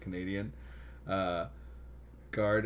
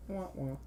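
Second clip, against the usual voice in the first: mock wah wah; 9 semitones higher, 6 dB quieter than usual. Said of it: "mock sad trombone".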